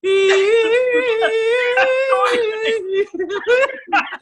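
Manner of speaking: loud, high squealing laugh; helpless laughter. Real, not acted. Cracks up at Lion King joke.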